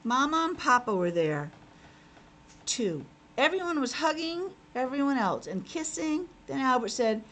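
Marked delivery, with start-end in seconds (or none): none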